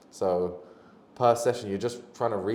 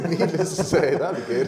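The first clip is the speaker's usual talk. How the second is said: laughing; 8 semitones higher, 8 dB louder than usual. Real, not acted. Laughing understatement.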